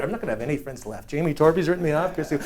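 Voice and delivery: low, quick, soft mutter; muttered aside. Retold or imitated. Boy admitting he's lost his friends, quick muttered aside. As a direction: slip it in under the pitch, hurried. Aside inside the sales pitch.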